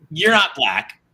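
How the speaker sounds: usual speaking voice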